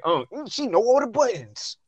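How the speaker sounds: high mocking whine; mock a sore loser in a high voice; whiny mocking voice. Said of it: "you sound like".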